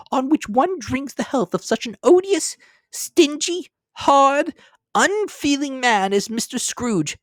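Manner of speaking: high, loud, biting mother's voice; sarcastic wife. A narrator's reading. Wife retorting to her husband, high sarcastic voice. Mrs Cratchit's voice.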